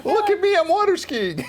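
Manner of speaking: high, loud showing off; hammed-up gleeful boasting; mock live-stream brag. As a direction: squeal it like a clueless show-off. Mocks a live-streaming sick caller.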